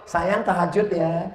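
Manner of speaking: soft, slow lovey call; sweet-talking. Pretend. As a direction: coo it down the phone at night.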